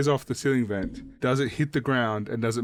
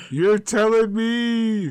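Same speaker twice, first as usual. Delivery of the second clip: loud exclaim; 9 semitones higher, 6 dB louder, much slower than usual. Acted. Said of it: "And he's like".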